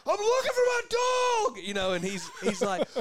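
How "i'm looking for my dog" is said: high, loud yell; high loud shouted call; retold yell. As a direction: shout it across the woods, pitched way up. Yelling through the woods.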